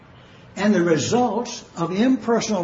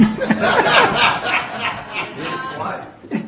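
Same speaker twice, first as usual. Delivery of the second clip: real burst of laughter; hearty laugh. Real, not acted. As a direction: crack up at your own story. Tickled by pastor's phone story.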